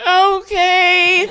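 a very high, nervous little okay; high squeaky okay. Retold or imitated. Nervously agreeing to go home.

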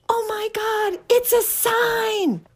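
very high, slow stretched gasp; amazed. Retold or imitated. Seeing the puppy named Rose, very high and amazed. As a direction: marvel like fate spoke, high and slow. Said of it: "I go".

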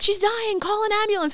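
hammed-up alarmed cleaner; panicked cleaning lady. Cleaning lady calling for help at the hotel, high mock panic. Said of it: "the cleaning lady's like".

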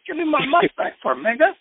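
high-pitched mock demand for it back. Imagining himself confronting a rapper about his mic, mock demand.

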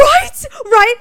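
high, loud gleeful agreement; excited squeal. Real, not acted. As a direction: squeal it with glee.

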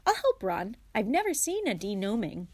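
ordinary delivery

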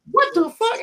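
very high-pitched imitation of a small child; little kid voice. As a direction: mimic your little son in a tiny high voice. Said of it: "with the little ass kid voice".

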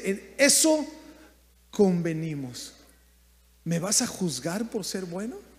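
a hint of a patient employer; calm landowner. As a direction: answer the complaint with quiet authority. Landowner answers the complaining worker.

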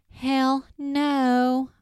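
high, loud, drawn-out hammed-up refusal; exaggerated refusal. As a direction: overplay a flat-out refusal, stretching both words. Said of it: "he didn't actually say it like that".

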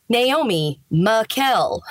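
Naomi Makel, slow, MAY-kel; name option one. Shown as a demonstration. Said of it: "one will be like".

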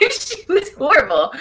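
laughing through the words; laughing out loud. Real, not acted. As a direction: breaks into a real laugh as she says it. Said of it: "laughing while telling".

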